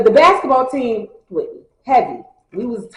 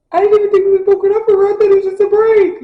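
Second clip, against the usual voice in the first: much higher, louder and fast, incredulous; baffled protest; 9 semitones higher, 5 dB louder, faster than usual. Retold or imitated. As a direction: blurt it out in disbelief. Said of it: "I'm just sitting there like".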